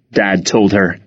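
usual speaking voice

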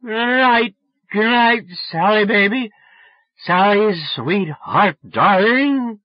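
put-on high, loud drunken crooning call. A narrator's reading. Drunk boy after Sally hung up, loud sloppy endearments. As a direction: gush endearments sloppily at full volume. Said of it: "imagine how drunk I was".

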